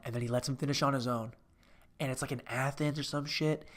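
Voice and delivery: usual speaking voice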